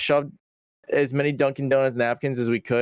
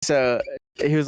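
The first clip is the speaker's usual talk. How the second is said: ordinary talk, nothing marked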